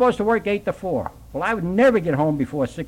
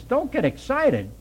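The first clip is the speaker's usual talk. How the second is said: incredulous; 7 semitones higher, slower than usual. Retold or imitated. Incredulous echo of nun.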